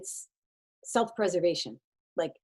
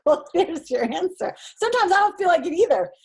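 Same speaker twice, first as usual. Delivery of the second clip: higher, louder teasing comeback; pointed retort; 9 semitones higher, 5 dB louder than usual. Retold or imitated. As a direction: land the punchline with a grin. Her punchline retort to swimmers.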